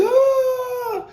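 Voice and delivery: high sharp scream; retold high yelp of alarm; startled scream. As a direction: let out a sudden high cry of alarm. Scene of the guard hitting the gate.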